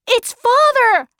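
high, astonished cry; sudden shout. A narrator's reading. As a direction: burst out high and excited. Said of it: "Sandy suddenly shouted".

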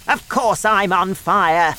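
loud, high, exasperated grandma; shouting. A narrator's reading. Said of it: "she shouted".

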